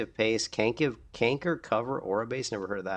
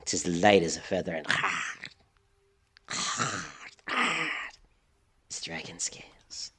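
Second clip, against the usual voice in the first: fading to a whisper; 4 semitones lower, 4 dB quieter than usual. Retold or imitated. Quoting game NPC line, fading.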